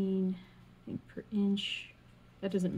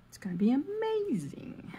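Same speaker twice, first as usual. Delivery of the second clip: high breathy whisper of excitement; excited whisper; 8 semitones higher than usual. Real, not acted. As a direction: whisper it like a happy secret.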